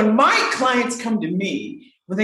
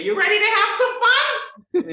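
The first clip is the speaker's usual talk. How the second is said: playful question. Retold or imitated. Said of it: "And I say".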